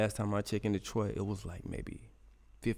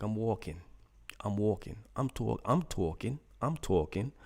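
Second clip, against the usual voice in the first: imitated New York talk; 3 semitones higher than usual. Imitating his wife doing New York talk, mock accent. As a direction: mimic a New Yorker, "tawkin'". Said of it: "I laugh at the New York, um, uh, talk".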